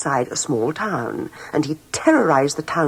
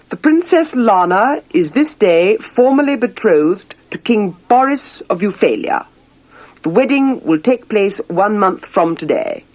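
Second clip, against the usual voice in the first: royal proclamation; 4 semitones higher, 4 dB louder than usual. Acted. King's formal public announcement.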